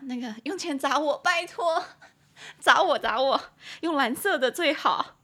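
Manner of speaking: high, loud pleading whine; half-crying joke plea to throw money; mock begging. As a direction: beg for cash in playful mock desperation. Jokingly begging listeners for money.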